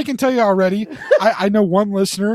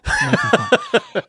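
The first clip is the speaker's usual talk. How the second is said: high sing-song tease; slower than usual. Real, not acted.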